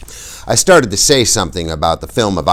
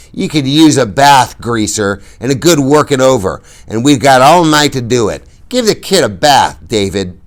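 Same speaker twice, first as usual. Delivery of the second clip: put-on louder drunken menace; menacing taunt; 7 semitones higher, 7 dB louder than usual. A narrator's reading. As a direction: savour the threat. Bob threatening drunkenly.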